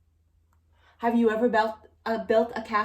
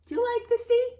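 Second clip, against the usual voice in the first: playful question; 4 dB louder, faster than usual. Pretend. Asking child playfully.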